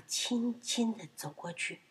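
very soft, stealthy narration; tiptoeing hush. A narrator's reading. (轻轻地、悄悄地讲)

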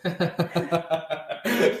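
laughing. Real, not acted. Guest laughs at sibling joke.

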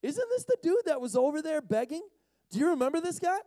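high-pitched curious questioning; gossiping neighbours. Acted. Neighbours asking about the former beggar, high-voiced curiosity. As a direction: put on a high voice for puzzled onlookers. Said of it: "those who had formerly seen him begging asked".

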